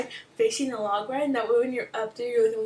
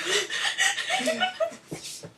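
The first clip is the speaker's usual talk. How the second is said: very high-pitched laughing fit; imitated uncontrollable laughing. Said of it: "I'm just like".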